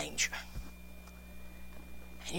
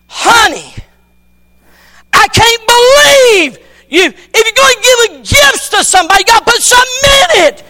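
much higher, loud, near-crying shout; frustrated father; 16 dB louder than usual. Retold or imitated. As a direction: blow up at her, voice straining. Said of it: "He's so frustrated".

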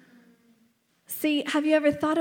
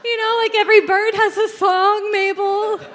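loud, high mock-desperate parent; exaggerated high-pitched plea to a child; hammed-up explaining; 7 semitones higher, 8 dB louder than usual. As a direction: belt it out as if it explains everything. Acted flailing explanation to child.

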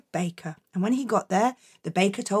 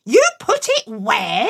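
disbelieving mum; 8 semitones higher, 4 dB louder, slower than usual. Acted.

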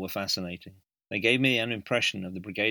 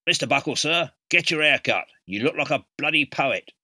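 loud sergeant major; 6 semitones higher, 7 dB louder than usual. Retold or imitated. Sergeant major rebukes cadet on parade.

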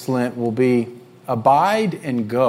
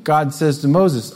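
usual speaking voice

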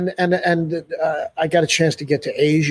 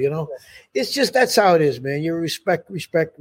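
ordinary delivery

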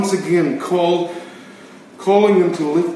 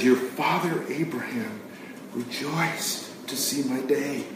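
quiet, not yelling; 4 semitones lower, 9 dB quieter than usual. Shown as a demonstration. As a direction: say it softly, no yelling at all. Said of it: "He's not yelling".

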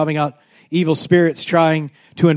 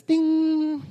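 high, soft held ding; magic-wand ding; sung chime "ding"; 11 semitones higher, 6 dB quieter than usual. Acted. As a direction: imitate a little bell ringing out. Mimics name used as magic.

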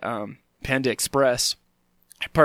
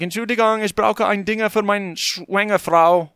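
broken German; 7 semitones higher, 3 dB louder than usual. Retold or imitated. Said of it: "which I think is sort of like saying".

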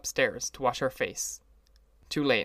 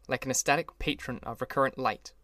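ordinary delivery

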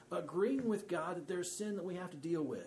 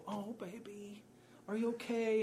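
tender coddling; 5 semitones higher than usual. Retold or imitated. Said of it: "I did not go".

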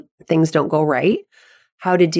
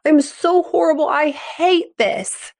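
higher, whiny mock despair dragging out I hate this; distraught athlete; 9 semitones higher than usual. Kid after a bad practice telling the parent, mock despairing wail. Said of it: "they come in and they're saying".

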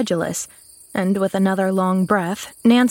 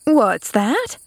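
higher, quick-rising question; excited interruption; 4 semitones higher than usual. A narrator's reading. Maid interrupting the girl's account, excited. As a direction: cut in excitedly as Nancy. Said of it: "interrupted Nancy excitedly".

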